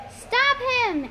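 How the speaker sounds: high, urgent shouted order; yell. A narrator's reading. Girl yelling as guard runs for the alarm. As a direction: yell it out high to stop someone. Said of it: "Annabeth yelled".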